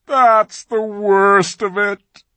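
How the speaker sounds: slow, loud, high groan of words. A narrator's reading. Fawn groaning to Lucy in shame. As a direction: moan it out. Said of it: "with a deep groan".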